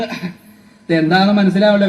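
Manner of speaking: ordinary talk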